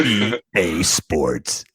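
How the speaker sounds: very slow, low sports-game tagline; video game announcer. Acted. Host mimics the game tagline, deep slow announcer voice. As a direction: draw it out deep, like a game promo. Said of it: "you have to do it like that".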